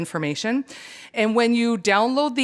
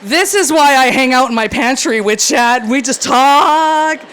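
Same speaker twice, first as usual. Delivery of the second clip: excited; 6 semitones higher, 11 dB louder, slower than usual. Real, not acted. Gushing to audience about chatting.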